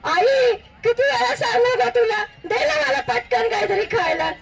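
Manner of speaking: high, exasperated mock child yell; Raju yelling. A narrator's reading. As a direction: nag mum as a starving kid. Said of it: "पुन्हा जोरात ओरडला आईच्या नावाने".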